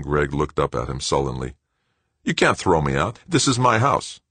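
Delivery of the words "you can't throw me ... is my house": indignant protest. Acted. Son to stepfather, indignant at being evicted. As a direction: push back, outraged.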